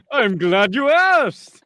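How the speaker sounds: high, loud, slow showman vampire; counting vampire. Acted. Vampire lookout delighted to be asked to count, grandly hammed-up. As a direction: relish it slowly and loudly, hammed-up.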